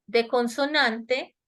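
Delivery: ordinary talk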